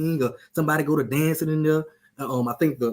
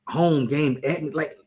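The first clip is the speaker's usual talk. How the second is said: ordinary delivery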